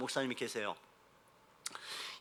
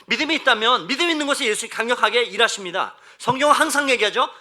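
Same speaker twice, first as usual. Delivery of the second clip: loud, raised sermon voice; forceful preaching; 7 semitones higher, 9 dB louder than usual. Acted. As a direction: proclaim it to the whole congregation. (높고 크게 선포하는 설교조)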